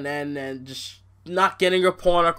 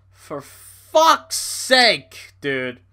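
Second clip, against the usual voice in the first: drawn-out exasperation; 6 dB louder, much slower than usual. Real, not acted. Exasperated at Mickie James.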